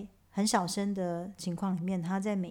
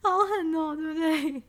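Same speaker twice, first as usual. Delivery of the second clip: amazed exclamation; 8 semitones higher, 4 dB louder than usual. Real, not acted. Marvelling at extreme slow tempo.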